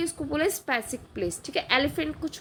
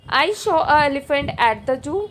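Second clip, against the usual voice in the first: slow quiz option read; 5 dB louder, much slower than usual. Shown as a demonstration. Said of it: "Which sentence is correct?".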